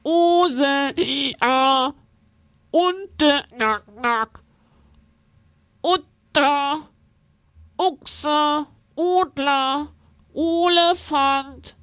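trumpeting with pinched nose. A narrator's reading. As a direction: hold your nose and trumpet. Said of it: "Er hält sich die Nase zu und trompetet los".

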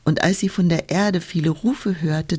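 usual speaking voice

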